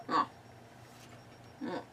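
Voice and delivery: ordinary talk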